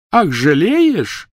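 high, very slow mocking echo; scornful. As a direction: throw his word back in scorn. Hermione scornful.

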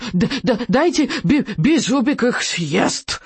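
stuttering, high little-dragon wail; Беззубик. A narrator's reading. Dragon can no longer hold back, stuttering howl. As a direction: howl it out in a stammering creature voice. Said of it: "взвыл Беззубик".

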